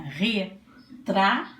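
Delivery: "Retrato" slowly broken into re, tra, to; syllable by syllable. Shown as a demonstration. Teacher asking pupils to count openings, "Retrato" syllable by syllable. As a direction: count out "Retrato" in three separate beats. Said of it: "Quantas vezes eu abri a minha boquinha?".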